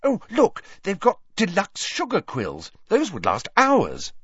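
high, eager exclamation; forced enthusiasm. Acted. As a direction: seize on a distraction brightly. Hastily changing the subject, feigned enthusiasm.

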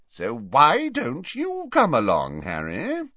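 jovial professor insisting; hearty demand. Acted. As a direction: press him grandly, drawing the words out. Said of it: "demanded Slughorn".

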